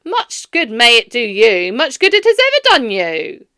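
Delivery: loud, bitter sarcasm; sneering Scrooge. A narrator's reading. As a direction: twist the knife.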